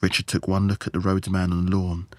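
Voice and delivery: ordinary delivery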